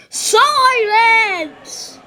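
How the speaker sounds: a loud, high shout for silence; the director bellows to silence everyone. Acted. Director shouting at the grumbling crowd. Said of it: "the director said".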